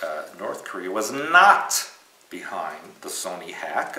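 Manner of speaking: ordinary talk, nothing marked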